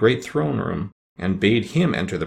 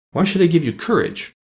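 demanding; 8 semitones higher than usual. Acted. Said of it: "demanded Oz".

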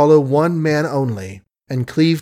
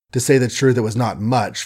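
plain reading voice

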